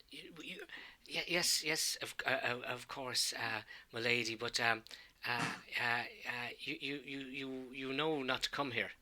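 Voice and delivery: hushed, halting younger priest; cowed stammer. Acted. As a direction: stammer softly, afraid to be overheard. Younger priest cowed, answering his lady.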